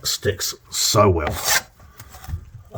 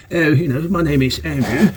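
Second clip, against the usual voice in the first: put-on posh English accent; 5 semitones higher, 4 dB louder than usual. To camera, mocking English speakers, posh English accent. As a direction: play at a refined English accent. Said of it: "more refined accent".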